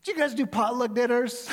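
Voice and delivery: high, loud, dopey question; stoned caller. Retold or imitated. Stoned caller asks the pastor about potluck dinners. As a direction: ask hopefully like a mellow stoner.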